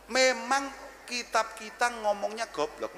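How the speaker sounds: slow, drawn-out repeat; slow and deliberate. Shown as a demonstration. Repeating to the audience what the book says, slowly. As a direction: lay each word down slowly.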